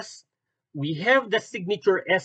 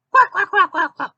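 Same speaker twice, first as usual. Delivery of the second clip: high, loud cartoon quacks; panicked quacking; 13 semitones higher, 6 dB louder than usual. Troublemakers run away from Batman, panicked quacking. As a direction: squawk rapid quacks in flight.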